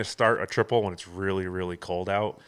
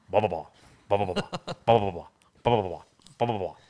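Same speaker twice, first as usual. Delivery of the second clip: sputtering engine; faster than usual. Retold or imitated. Imitating engine failing to start.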